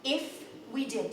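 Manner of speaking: usual speaking voice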